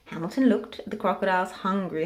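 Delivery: ordinary delivery, nothing marked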